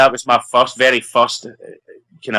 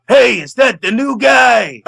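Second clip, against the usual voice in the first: much higher, slow, called across a field; retold shout; 11 semitones higher, 3 dB louder, slower than usual. Sergeant spotting the new guy on set, mock shout. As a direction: imitate a sergeant bellowing from afar. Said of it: "heard this voice shouting".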